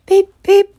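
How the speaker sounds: very high, loud train whistle beeps; whistle imitation. A narrator's reading. Storyteller making Edward's whistle very high. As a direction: squeal it like a steam whistle. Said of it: "whistled Edward".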